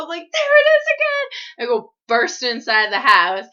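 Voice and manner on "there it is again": panicked shriek. Retold or imitated. On the porch with her brother-in-law, panicked high shriek.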